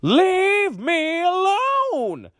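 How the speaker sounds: drawn-out, very high, loud shout. A narrator's reading. Old woman yelling back at her family as she leaves, drawn-out shout. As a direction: stretch it out in a huge high yell. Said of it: "the old woman shouted".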